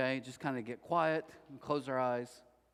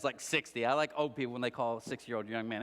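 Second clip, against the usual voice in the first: usual speaking voice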